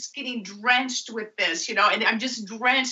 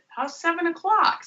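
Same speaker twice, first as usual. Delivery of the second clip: higher, keen question; eager suggestion; 10 semitones higher than usual. Retold or imitated. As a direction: propose it with eagerness. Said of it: "I said".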